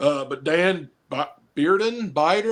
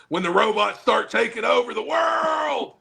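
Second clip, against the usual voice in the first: mock rallying cry; 7 semitones higher than usual. Mock doomsday conspiracy guy's rallying cry.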